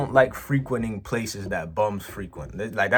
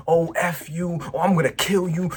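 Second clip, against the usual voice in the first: imitating yelling man; 7 semitones higher than usual. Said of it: "starts yelling at me".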